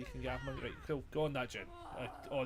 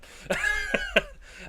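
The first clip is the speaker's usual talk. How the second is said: high laugh; 9 dB louder than usual. Real, not acted. Bursts out laughing at the game.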